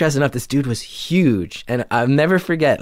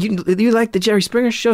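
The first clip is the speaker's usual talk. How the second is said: feigned surprise; 10 semitones higher than usual. Retold or imitated. Feigned friendly question to bully.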